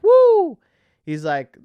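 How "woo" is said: loud, high "woo" mimicking a crowd member; imitated audience woo. Imitating a lone audience member at the monologue, high loud whoop. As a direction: holler one long, high woo like a lone fan. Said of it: "somebody's like".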